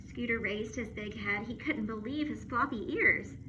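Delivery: plain reading voice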